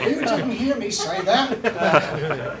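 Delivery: sheepish laugh at his own rude joke. Real, not acted. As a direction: laugh it off, words stretched through the laughter. Laughing after swearing at crowd.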